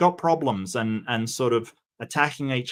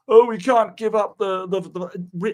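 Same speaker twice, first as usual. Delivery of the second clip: higher, louder mock whine about the flag; mocking flag lovers; 8 semitones higher, 5 dB louder than usual. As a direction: parody people clinging to a flag. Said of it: "They're like".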